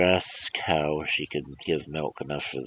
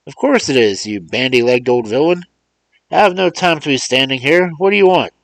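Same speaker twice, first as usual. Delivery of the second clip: high and loud, brusque and irritated; impatient Mars John; 8 semitones higher, 9 dB louder than usual. Acted. Mars John scolds Remus down the phone, impatient. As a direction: snap at the old man to hurry.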